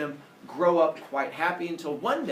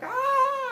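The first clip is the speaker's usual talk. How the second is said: very high horrified scream; mock villagers' scream; 4 dB louder than usual. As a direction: let out a cartoonish high shriek. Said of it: "they start screaming like".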